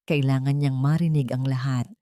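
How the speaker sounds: ordinary delivery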